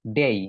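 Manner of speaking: "ডেই" gliding from e to i; correct pronunciation. Shown as a demonstration. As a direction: say "ডেই" with a full ei diphthong. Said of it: "right উচ্চারণ হচ্ছে ডেই".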